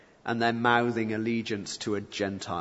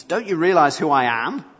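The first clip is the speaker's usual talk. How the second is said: irritated demand; 4 semitones higher, 8 dB louder than usual. Acted. Pilate's irritation acted.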